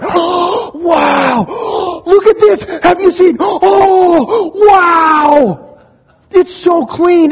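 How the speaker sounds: high, loud, hammed-up gushing with gasps; squealed awe, stretched out; overjoyed amazement. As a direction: imitate overwhelmed winners shouting in wonder. Voices overwhelmed family seeing house.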